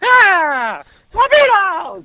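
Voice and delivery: high, drawn-out mock war cry; Howard, chest puffed out. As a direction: imitate a puffed-up man proclaiming his team. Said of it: "Howard's got his chest puffed out".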